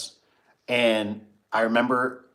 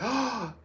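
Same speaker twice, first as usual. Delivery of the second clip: surprised gasp; 13 semitones higher, 4 dB quieter than usual. Retold or imitated. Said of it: "I looked and I was like".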